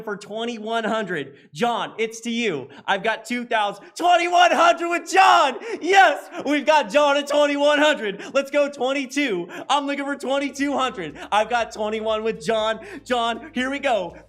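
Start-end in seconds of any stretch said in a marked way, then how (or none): 4.0-11.2 auctioneer shout. Real, not acted. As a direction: call the bid out to the room. Auctioneer calling a new bid.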